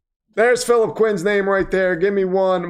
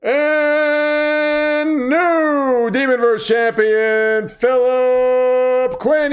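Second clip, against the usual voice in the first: hugely drawn-out ring-announcer call, raised pitch; title announcement; 4 semitones higher, much slower than usual. Pretend. Host redoing the champion announcement, drawn-out ring-announcer call. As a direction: stretch AND and the name like a ring announcer. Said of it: "The title announcement. We gotta do it over again".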